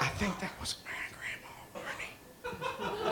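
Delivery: awed, hushed whisper with a laugh. Retold or imitated. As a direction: breathe it out in awe. Brother whispering about the ghost.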